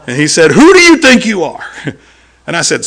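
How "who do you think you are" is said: loud, high indignant challenge; angry challenge. Retold or imitated. Angry host to visiting preacher, loud high indignant challenge. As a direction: fling the question back hard.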